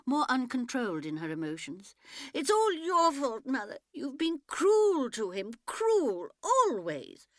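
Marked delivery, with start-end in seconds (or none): 2.3-7.3 high, loud tearful accusation; distraught girl. A narrator's reading. Younger daughter accusing her mother, high and tearful. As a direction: hurl the blame, voice breaking high.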